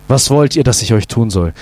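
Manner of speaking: low, gentle, unhurried question; tender and loving. A narrator's reading. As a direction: ask like a king bending kindly to his children. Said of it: "Wie zart, wie liebevoll".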